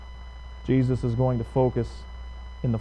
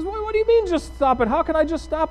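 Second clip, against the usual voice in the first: high-pitched imitation of indignant protest; mock upset client, pitched way up; upset patient; 13 semitones higher than usual. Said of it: "she gets upset with him".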